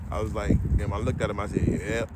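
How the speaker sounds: understated low mock agreement; low, soft wry retelling. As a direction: murmur the comeback to yourself. Retelling the haggle.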